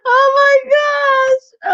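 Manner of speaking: long, high, laughing wail; overcome with laughter. Real, not acted. Woman laughing at her own fall, high drawn-out exclamation. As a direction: stretch it out, worn out from laughing.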